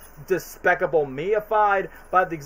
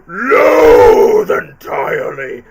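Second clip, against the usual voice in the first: slow, hugely shouted loathing; Jim Carrey Grinch; 13 dB louder, much slower than usual. Retold or imitated. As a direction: bellow it like the Grinch at full rage. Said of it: "with my Carrey voice".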